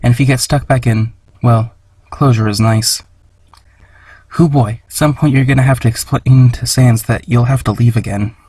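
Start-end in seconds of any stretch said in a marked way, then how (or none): none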